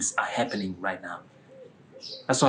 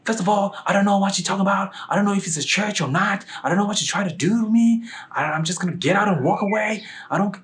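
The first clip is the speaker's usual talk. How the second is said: fast, high, louder protest; indignant brush-off; 10 semitones higher, 4 dB louder, faster than usual. Retold or imitated. Woman refusing a church invitation, indignant and fast. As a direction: rattle it off, pitched high and pushy. Acts out her refusing outburst.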